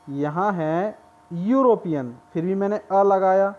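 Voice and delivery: usual speaking voice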